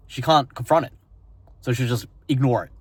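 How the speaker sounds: ordinary talk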